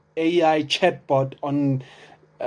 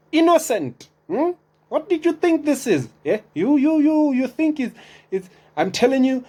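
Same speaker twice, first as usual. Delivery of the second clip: mock scolding; 11 semitones higher than usual. Mock scolding of unsuspecting scroller.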